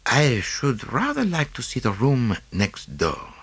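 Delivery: lightly voiced Belgian detective, precise and pleased; a hint of a fussy foreign sleuth; Poirot. A narrator's reading. Poirot explaining his test to Hastings, lightly voiced.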